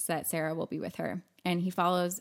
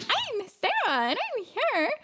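much higher, slow, squeaky put-on voice; squeaky fake Sarah; slower than usual. Recapping the film, Nancy posing as Sarah in a squeaky voice. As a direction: mimic a witch faking a sweet girl, very high and slow. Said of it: "Nancy as Sarah sounds like a dolphin".

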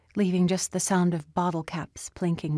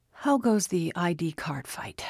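thin, tired whispered question; weary whisper. Acted. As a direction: ask it drained and whispery. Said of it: "his voice thin and weary".

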